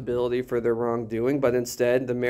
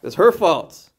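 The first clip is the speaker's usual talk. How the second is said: louder, higher accusation; mock blaming; 4 semitones higher, 7 dB louder, slower than usual. Adam points to his wife, mock blaming out loud. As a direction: pin the blame out loud. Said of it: "he points to his wife. He says".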